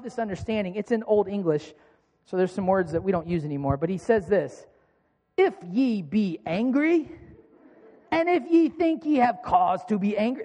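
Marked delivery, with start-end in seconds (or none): 5.4-10.4 slow, hammed-up British reading; British accent. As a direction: read it in an amateur British accent. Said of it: "that's my British accent".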